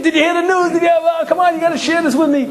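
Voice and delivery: high excited shout, laughing; overjoyed greeting. Pretend. Overjoyed winner to the man who stepped on him, high laughing shout. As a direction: hug him and shout the good news.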